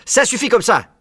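loud, high reprimand; stern cut-off. A narrator's reading. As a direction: put a stop to it. Fox snatches jar from cub.

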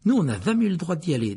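envious. A narrator's reading. Ron was never allowed there, envious. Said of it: "dit Ron avec envie".

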